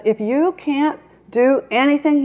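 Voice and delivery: high, sharp brush-off; a little parrot too busy to argue; impatient parrot. A narrator's reading. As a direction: wave the hawk off, no time to spare. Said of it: "He said".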